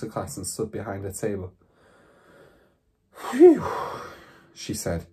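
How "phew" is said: high, loud exhaled whew; relieved phew. A narrator's reading. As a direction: blow out a sigh of relief. Teacher's relief after headmistress leaves.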